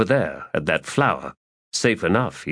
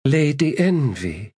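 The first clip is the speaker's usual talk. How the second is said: high, slow courtly greeting; formal greeting; 9 semitones higher than usual. Acted. Priestess greeting her host, high and courtly.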